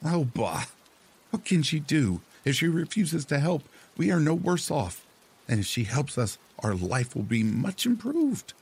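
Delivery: put-on high, scornful old wife voice; scoffing wife. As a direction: wave away his worry with scorn. Wife brushes off husband.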